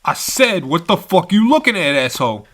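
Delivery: loud, high, insistent threat; angry repeated challenge. Acted. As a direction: demand it harder, spoiling for a fight. Thug repeats his challenge.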